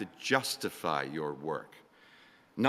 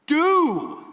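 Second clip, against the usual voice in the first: sudden shouted word; 9 dB louder than usual. Acted. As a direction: burst out with it like a command. Echoing the shouted command.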